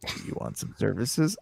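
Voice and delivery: slow, lightly imitated proposition; second solicitor. Another person offering services, slow imitation. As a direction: ease slowly into a retold offer. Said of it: "another person".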